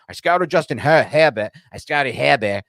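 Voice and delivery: put-on a loose Boston accent, Herbert said as Hebbet with no R; a playful imitation of a Boston football GM; mock Boston accent. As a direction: drop the R's Boston style, Herbert as Hebbet. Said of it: "that Boston accent".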